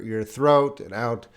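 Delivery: ordinary talk, nothing marked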